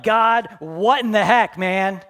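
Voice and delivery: a loud, slow, exasperated complaint to heaven; angry outburst. Retold or imitated. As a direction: vent the frustration at God.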